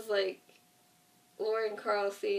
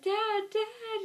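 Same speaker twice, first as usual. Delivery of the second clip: put-on much higher, louder cry of a kid; child calling out; 13 semitones higher, 4 dB louder than usual. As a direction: imitate Carl running to his dad, calling. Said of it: "He's like".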